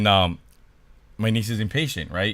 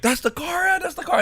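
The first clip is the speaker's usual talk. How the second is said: very high, urgent squeal without extra volume; imitated high-pitched alarm at a discovery; excited spotting. Niece spots car in parking lot, excited.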